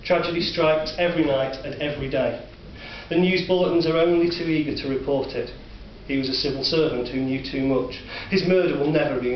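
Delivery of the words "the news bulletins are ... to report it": ordinary delivery, nothing marked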